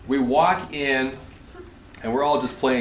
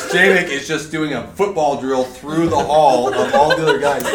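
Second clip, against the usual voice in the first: normal talking voice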